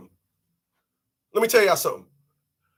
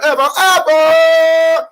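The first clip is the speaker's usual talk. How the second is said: stretched shout; 6 semitones higher, 6 dB louder, much slower than usual. Acted. Comic insisting nobody ever says it, stretched high shout. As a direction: drag the word out, high and loud.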